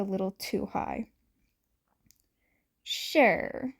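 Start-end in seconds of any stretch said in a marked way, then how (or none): 2.8-3.7 smirking, cooing sure; knowing sly coo. A narrator's reading. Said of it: "Shirakumo cooed while smirking".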